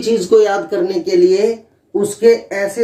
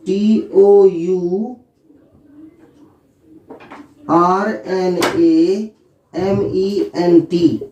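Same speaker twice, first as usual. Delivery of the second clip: letters dictated very slowly; slow spelling out; much slower than usual. Shown as a demonstration. Teacher dictating the spelling of tournament, slow. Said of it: "एक word होता है".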